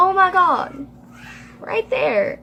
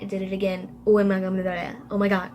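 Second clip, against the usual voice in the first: usual speaking voice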